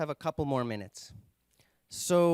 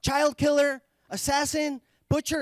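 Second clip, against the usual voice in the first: mock angry crowd; 10 semitones higher, 5 dB louder than usual. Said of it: "other people chime in".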